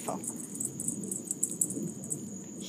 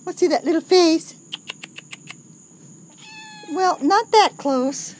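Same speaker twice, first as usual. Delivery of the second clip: louder, excited pet talk; louder, higher excited talk to a cat; 6 semitones higher, 8 dB louder than usual. Real, not acted. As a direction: raise the voice, delighted at the cat. Cat comes right up to camera.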